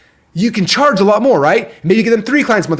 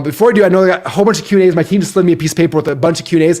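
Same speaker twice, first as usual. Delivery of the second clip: usual speaking voice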